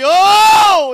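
loud, high, drawn-out "oh"; imitated prayer moan. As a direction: wail it out, reenacting anguished prayer. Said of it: "I was a moaning before God".

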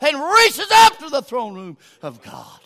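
loud, high, driving revival shout; full-force preaching. Real, not acted. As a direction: holler each phrase at fever pitch.

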